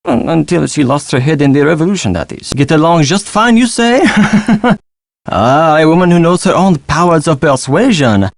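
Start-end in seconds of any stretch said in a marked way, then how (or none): 4.0-4.8 short run of chuckles; amused chuckle. Acted. Frenchman amused by a persuasive woman, chuckling. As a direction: chuckle knowingly before replying.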